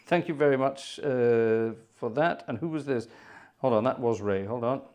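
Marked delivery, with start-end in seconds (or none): none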